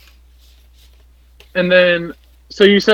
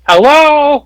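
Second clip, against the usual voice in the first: louder, higher hello; calling out; 9 semitones higher, 6 dB louder than usual. Acted. Trapped player calling out in the dark, loud. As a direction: call into the darkness.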